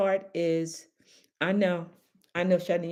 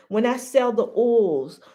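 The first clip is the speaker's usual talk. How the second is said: ordinary talk, nothing marked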